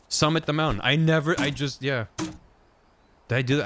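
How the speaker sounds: ordinary delivery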